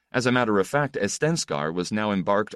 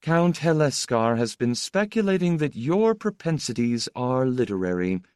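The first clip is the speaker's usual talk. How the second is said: higher-pitched, drawled society remark; drawling; 6 semitones higher than usual. A narrator's reading. Teasing a guest about literary propensities, drawling.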